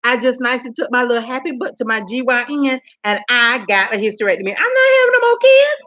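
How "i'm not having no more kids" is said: high, loud declaration; emphatic outburst. Real, not acted. Vlogger loudly refusing to have more kids. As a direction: shout it so nobody argues.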